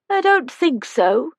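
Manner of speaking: put-on thin, high, slow frail old voice; a tiny old woman calling from the doorway; high-pitched old woman. A narrator's reading. Old resident greets the visitors, high-pitched and slow.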